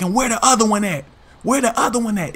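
driver demanding. Retold or imitated. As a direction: press them for the other one.

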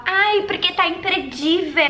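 slow, gushing influencer voice; mock gushing blogger. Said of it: "quando as blogueiras falam". (voz de blogueira exagerada)

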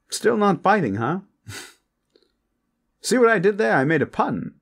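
high, loud, showy come-on; flirtatious braggart Hakan. Acted. Hakan brags to a reluctant guest, flirtatious and over the top.

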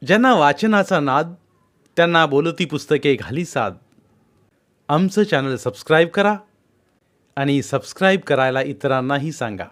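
bright promo read; channel intro. Acted. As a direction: announce the channel with cheer.

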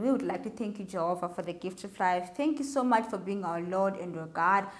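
soft, quiet prayer voice, trailing off at the ends. Acted. Preacher leading the children in a soft, hushed prayer. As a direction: pray gently and reverently for the children.

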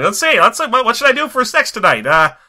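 high-pitched, hammed-up gangster voice; Quentin Tarantino. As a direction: squeal it like a delighted old-time gangster. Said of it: "Quentin Tarantino rolls his dice".

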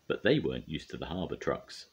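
usual speaking voice

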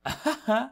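high sinister chuckle; sinister laugh. Acted. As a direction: laugh darkly at his no. Villain laughs at refusal.

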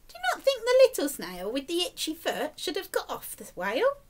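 usual speaking voice

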